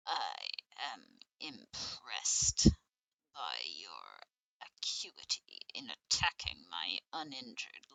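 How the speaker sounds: slow, low, drawn-out doctor voice; game doctor in character. Acted. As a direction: play the doctor deep and deliberate, stretching the words.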